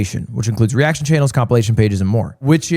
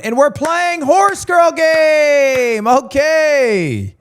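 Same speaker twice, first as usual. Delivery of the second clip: hyped intro shout; 12 semitones higher, much slower than usual. Real, not acted. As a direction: kick off the stream big. Loud hype stream intro.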